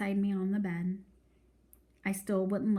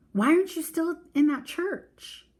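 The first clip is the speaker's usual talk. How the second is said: choky; 9 semitones higher, 4 dB louder than usual. A narrator's reading. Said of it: "Her voice was starting to get all choky".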